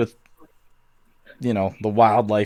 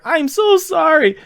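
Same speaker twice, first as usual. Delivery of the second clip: high-pitched scientist voice. Acted. Kip, high character voice.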